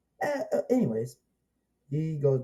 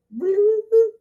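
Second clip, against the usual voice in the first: much higher, louder mock squeal of laughter; imitated high laugh; 10 dB louder than usual. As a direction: copy the character's giddy shriek. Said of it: "and then goes".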